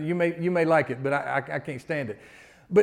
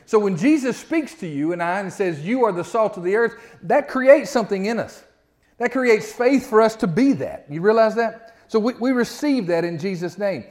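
preaching; 3 semitones higher than usual. Acted. Pastor preaching to believers, building intensity. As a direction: build the fire as you preach.

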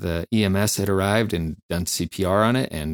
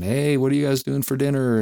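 higher, breezy dinner question; casual chatter; 6 semitones higher than usual. Retold or imitated. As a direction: act out offhand small talk among coworkers. Acting out casual chat afterwards.

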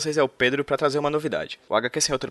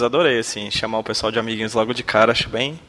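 ordinary talk

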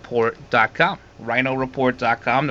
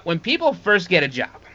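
normal talking voice

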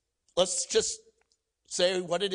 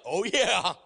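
eager disbelief; 6 semitones higher than usual. Retold or imitated. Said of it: "Stephen's like".

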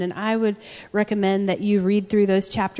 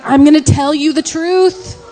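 loud, higher, forceful declaration; emphatic dad; 6 semitones higher, 8 dB louder than usual. Retold or imitated. Husband telling his daughter the truth, loud and emphatic. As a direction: announce it with full conviction. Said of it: "He's like".

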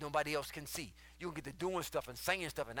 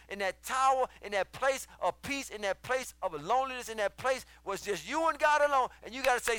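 loud, high sermon delivery; full-force preaching; 8 semitones higher, 11 dB louder than usual. Acted. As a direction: drive it home to the congregation like a preacher.

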